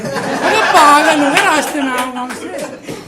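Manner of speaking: loud, high mock exasperation; exasperated outburst. Real, not acted. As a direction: vent with comic disbelief. Comic outburst at jija. (जीजा जी पर झल्लाता हुआ तेज़, हल्का मज़ाकिया गुस्सा)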